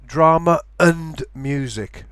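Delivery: slow DRAMA AND MUSIC, glottal stop before AND; glottal stop linking. Shown as a demonstration. Teacher avoids linking sounds, glottal stop before AND. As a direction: break DRAMA and AND apart with a glottal catch. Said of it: "I'm putting a glottal stop".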